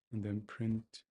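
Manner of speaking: ordinary talk, nothing marked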